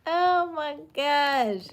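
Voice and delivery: high, loud, slow exhale of laughter; winding down from laughing, high, loud and slow; laughing sigh. Real, not acted. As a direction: come down off a big laugh. Recovering from laughter.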